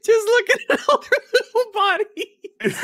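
high-pitched helpless laughter; laughing. Real, not acted. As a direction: speak through a laughing fit. Laughing at fan art.

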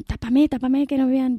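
terrified bear begging the farmer to hide him; frightened bear. Acted. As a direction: beg to be hidden, scared stiff.

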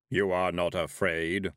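slow, heavy voice of Death; heavy thundering voice. A narrator's reading. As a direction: let it rumble like distant thunder. Death asks Tiffany.

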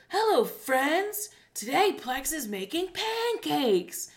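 put-on high, slow kids' show host voice; over-enunciate like a hammed-up children's presenter; exaggerated, drawn-out sing-song greeting; DJ Lance. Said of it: "here's why I'm doing this voice".

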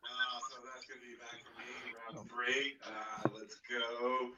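very faint, far-off audio dropout; mic cutting out. Real, not acted. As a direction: voice fading out on a broken mic. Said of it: "we can barely hear you".

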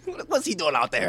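high loud outraged fan; indignant fan. Pretend. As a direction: yell like a confused fan. Fans voiced indignantly.

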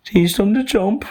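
very high cartoon kangaroo voice. Kangaroo insists on jumping lessons, high character voice. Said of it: "said the kangaroo".

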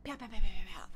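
loud rapid "pa-pa-pa" noise; imitated shattering. Pet owner illustrating a heart breaking apart, percussive. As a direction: rattle off the sound of pieces breaking.

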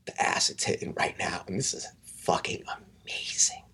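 hushed, breathy amazement; awed whisper. Retold or imitated. Friend on acid riding a camel, awed whisper. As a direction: whisper it, blissed out and marvelling.

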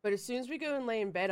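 usual speaking voice

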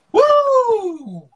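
loud, high-pitched "woo"; big rising-voiced whoop; startled whoop. Real, not acted. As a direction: let out a sudden high whoop. Exclaims while getting gecko out.

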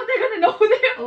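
high, laughing alarm; laughing panic. Real, not acted.

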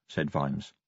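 usual speaking voice

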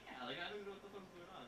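soft, high, hushed confusion; baffled quiet aside. Retold or imitated. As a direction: mutter it, totally lost.

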